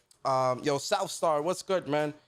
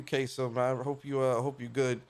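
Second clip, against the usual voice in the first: ordinary delivery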